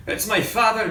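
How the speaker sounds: high, startled, breathy alarm; a boy caught by surprise, fearful; gasped. A narrator's reading. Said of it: "Nathaniel gasped".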